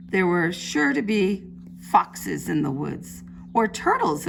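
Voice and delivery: lively picture-book storytelling; reading to children. A narrator's reading. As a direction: read it like storytime for little ones. Teacher reads picture book aloud.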